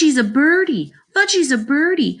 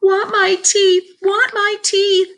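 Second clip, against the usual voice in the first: high, slow screamed demand; toddler screaming; 4 dB louder, slower than usual. A narrator's reading. Toddler demands his teeth back, slow high scream. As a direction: wail it like a tantruming little child. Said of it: "Fudge started to scream".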